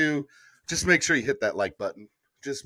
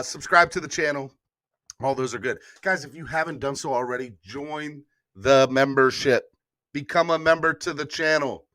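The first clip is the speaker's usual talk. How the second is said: normal talking voice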